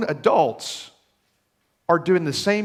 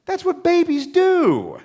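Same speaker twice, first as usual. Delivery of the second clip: higher, louder and slow, pressing it home; emphatic exclamation; 13 semitones higher, 6 dB louder, slower than usual. Real, not acted. Preacher telling congregation not to judge babies, slow emphatic exclamation. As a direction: drive home that babies cry. Defending the crying baby.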